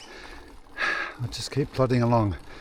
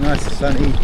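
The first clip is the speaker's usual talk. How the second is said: normal talking voice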